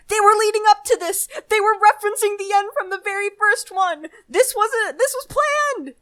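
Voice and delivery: dumbstruck excitement. Retold or imitated. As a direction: blurt it out as a stunned revelation. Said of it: "so dumbstruck because you were like".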